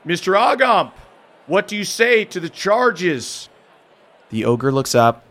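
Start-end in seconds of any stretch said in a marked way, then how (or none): 0.0-3.5 annoying authoritative voice. Acted. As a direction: read the charges like a pompous official. Captain questions the prisoner.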